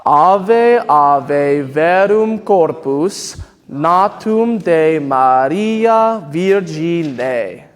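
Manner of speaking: slow, even Latin read-through for the choir to echo; slow Latin recitation. Shown as a demonstration. Choir director leads the singers, slow Latin recitation. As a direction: lead the choir through the Latin, slowly and evenly. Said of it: "I'll say it with you".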